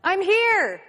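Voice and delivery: loud, high answering shout; calling back. Acted. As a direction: call out from a distance. Florica calls back from the swings.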